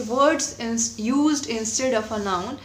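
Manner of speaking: slow, word-by-word definition; slow dictation. Shown as a demonstration. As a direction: read the definition out slowly for notes. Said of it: "definition आपको समझ में आ गया". (धीमी आवाज़ में परिभाषा लिखवाते हुए)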